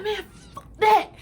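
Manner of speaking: high, loud, furious yell of protest; furious outburst. Retold or imitated. Furious at being blamed.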